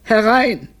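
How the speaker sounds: high, pained "come in"; suffering voice. Acted. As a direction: call out as if in pain. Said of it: "sagte Brunelli mit leidender Stimme".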